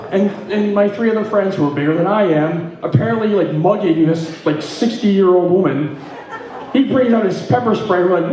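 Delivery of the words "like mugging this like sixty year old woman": usual speaking voice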